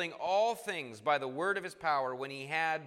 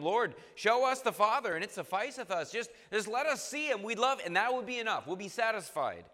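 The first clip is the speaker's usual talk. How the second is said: higher, louder eager plea; pleading Philip; 5 semitones higher, 4 dB louder than usual. Retold or imitated. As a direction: beg like Philip to see the Father. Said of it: "Philip saith unto him".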